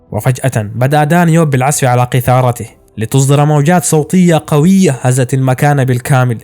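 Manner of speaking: ordinary delivery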